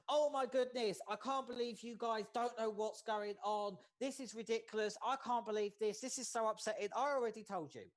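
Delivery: hammed-up frustrated host scolding; mock exasperation. Shown as a demonstration. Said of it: "Imagine if I come in and gone".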